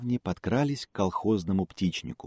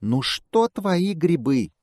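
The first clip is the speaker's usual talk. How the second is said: high squeaky squirrel voice; squeaking; 10 semitones higher, 4 dB louder, slower than usual. A narrator's reading. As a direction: squeak it slowly like a little squirrel. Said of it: "пищит белка".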